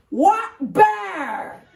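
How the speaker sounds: put-on high shouted old-timer question; Ed waking up confused in the car; indignant old man. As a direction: bark it out baffled.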